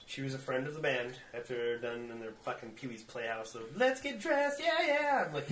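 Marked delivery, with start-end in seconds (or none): 3.8-5.2 much higher, bubbly; girly mock voice. Spice Girls in the movie, high girly mock. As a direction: chirp it like a pop star.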